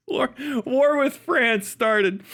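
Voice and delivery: mock announcement. Mock announcement of war, laughing.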